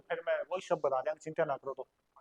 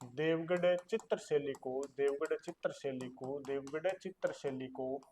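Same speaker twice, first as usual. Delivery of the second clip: slow, even repeating of Deogarh चित्रशैली को for students to copy; slow dictation; 3 semitones lower, 6 dB quieter, slower than usual. Shown as a demonstration. Teacher to online class, dictating the term slowly. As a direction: spell it out slowly so the class can write. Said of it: "teacher repeats term for notes".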